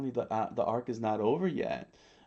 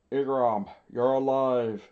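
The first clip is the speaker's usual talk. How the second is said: loud cry of astonishment; screamed out; 2 semitones higher, 6 dB louder than usual. A narrator's reading. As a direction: shout it out as an astonished commander. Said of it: "Chaka screamed out".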